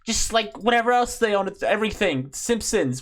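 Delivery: high, cheesy corporate enthusiasm; lightly voiced upbeat exec; Disney exec voice. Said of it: "like, you know, like".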